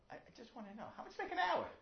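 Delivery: high, plaintive mock-tearful plea; crying child. Crying child pleading with father to know, high and upset. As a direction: plead like an upset kid, voice climbing sharply. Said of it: "He hears the child crying".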